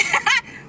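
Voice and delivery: short high-pitched burst of laughter; quick squeaky laugh; high giggle. Real, not acted. Laughing at her own story.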